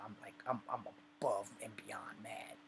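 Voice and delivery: hushed intense whisper; whispered, seething aside. Retold or imitated. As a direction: drop to a whisper to show how mad. Telling of rage, dropped to whisper.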